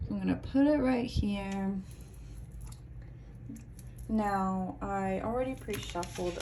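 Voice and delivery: hushed, unhurried card-reading voice; soft tarot reading voice. Acted. Tarot reader welcoming pile three, in a soft hushed voice. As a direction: welcome the viewer in gently as you lay out cards.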